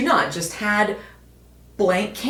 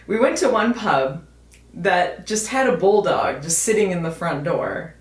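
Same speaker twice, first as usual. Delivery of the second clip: ordinary talk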